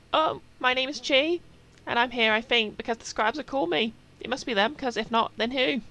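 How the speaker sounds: loud, high-pitched, uncertain little introduction; Chi. Acted. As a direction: pitch it way up and project, questioning at the end. Voices Chi introducing themself in game.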